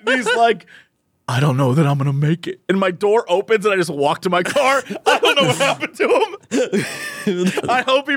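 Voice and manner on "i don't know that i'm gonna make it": low, defeated mock resignation; utter loss. Man tells neighbor he's doomed, imitated defeat. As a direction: say it like you've already failed. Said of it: "just complete loss".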